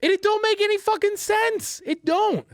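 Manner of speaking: high-pitched exasperated outburst. Real, not acted. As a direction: vent pure frustration at something senseless. Banging head over baffling loss.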